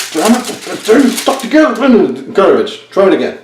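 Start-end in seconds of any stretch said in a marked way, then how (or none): 0.1-1.7 frustrated self-quote. Retold or imitated. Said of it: "because I'm like".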